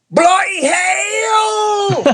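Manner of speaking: high, slow shrieked Bloody hell in a mock English accent; English accent impression. Imitating Barbara cussing in an English accent after a bump on the head. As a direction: cry it out like a startled Englishwoman hit on the head. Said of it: "this is my accent for y'all".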